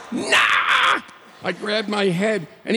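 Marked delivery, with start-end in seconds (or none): 0.1-1.0 gleeful yell. Retold or imitated. Driver brushing off the warning, gleeful high yell. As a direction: whoop with wild glee.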